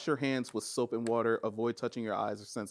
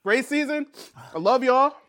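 loud, high show outro; host sign-off; 11 semitones higher, 10 dB louder than usual. Acted.

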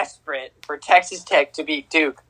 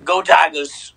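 Coach O; slower than usual. Acted. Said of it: "a better Coach O than you".

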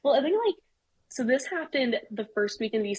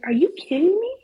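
high, loud disbelief; incredulous outburst; 8 semitones higher, 5 dB louder than usual. Retold or imitated. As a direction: hit the ceiling in disbelief. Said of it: "I'm like".

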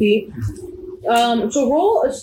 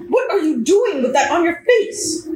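short, sharp question to the masked man; terse boss who wants an answer now; curt regional manager; 6 semitones higher than usual. Acted. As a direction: cut him off, all business. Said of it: "Just, like, very, like, curt".

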